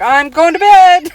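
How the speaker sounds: put-on high, loud, drawn-out kid's call; imitated child announcing.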